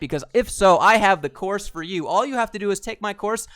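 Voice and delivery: mocking a get-rich course seller to viewers, loud and high; hyped YouTuber voice. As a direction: sell the fake course like a screaming influencer. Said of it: "this is how you have to be when you open up every single video".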